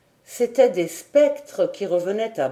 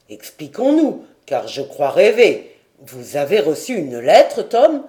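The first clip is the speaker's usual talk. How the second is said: louder, pressing and confused; bewildered demand; 4 semitones lower, 6 dB louder than usual. A narrator's reading. Lord who thinks he dreams questions sailor, bewildered. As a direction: press him for answers.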